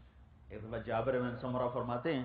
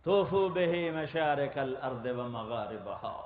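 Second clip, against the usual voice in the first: slow Arabic recitation; slower than usual. Acted.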